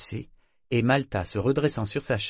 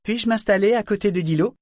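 put-on high, loud girlish pleading; a teenage girl, eager to explain; young girl voice; 10 semitones higher, 6 dB louder than usual. A narrator's reading. Girl asks her grandmother.

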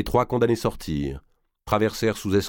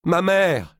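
crier vers sa mère, fort et haut; 11 semitones higher, 5 dB louder than usual. Acted. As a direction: appel désespéré lancé fort. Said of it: "lui cria Blanche". (cri aigu et puissant)

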